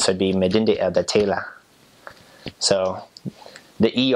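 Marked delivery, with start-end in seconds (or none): 0.3-1.5 Elder Taylor in a Twi accent, endings as "ah"; Ghanaian accent. Shown as a demonstration. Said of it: "say it sort of in their accent".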